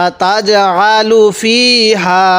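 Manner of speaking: আতাজআলু ফিহা read slowly, with ফি and হা each held for one count; one-alif elongation. Shown as a demonstration. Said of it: "ফি এর মধ্যে এক আলিফ টান দিব".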